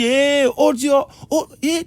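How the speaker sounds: high, loud call-out; acted plea to a shouting wife. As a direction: call out over someone yelling at you. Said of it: "elle crie, tu dis".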